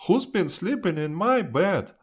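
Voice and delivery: high-pitched mother bear. A narrator's reading. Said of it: "says Mother Bear".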